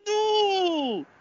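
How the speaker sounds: high pained cry of no; hit hard crying out. Real, not acted. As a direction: yelp in sympathetic pain. Anguished cries at brutal move.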